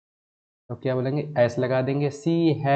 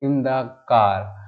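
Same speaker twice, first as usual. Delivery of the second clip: the corrected sentence read slowly; slow dictation of the correction; much slower than usual. Shown as a demonstration. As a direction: dictate it word by word. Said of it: "बोलेंगे इसको".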